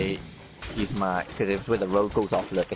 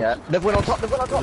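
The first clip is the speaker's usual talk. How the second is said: excited callout; 10 semitones higher than usual. Real, not acted. Spotting enemies mid firefight.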